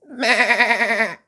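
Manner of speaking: high, loud bleating "Meh!"; sheep bleat. Acted. The bumped sheep bleating back, a loud high bleat. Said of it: "said the sheep".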